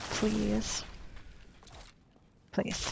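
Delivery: quiet, dismissive drawn-out please; sarcastic. Retold or imitated. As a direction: brush it off with disdain. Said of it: "she says it in a more of a sarcastic way".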